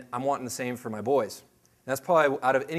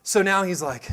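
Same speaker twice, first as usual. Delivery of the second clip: ordinary talk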